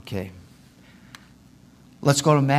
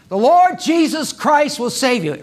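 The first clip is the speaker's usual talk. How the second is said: loud, high street preaching; street-preacher proclamation; 9 semitones higher, 6 dB louder than usual. Acted. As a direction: call it out to a downtown crowd. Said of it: "Come out some Thursday".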